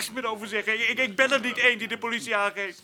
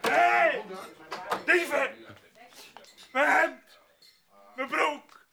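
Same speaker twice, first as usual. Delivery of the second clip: outraged shouts; 3 semitones higher, much slower than usual. Real, not acted. Robbed worker shouting at the barracks, alarmed. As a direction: yell for the thieves. Robbed man discovers stolen clothes.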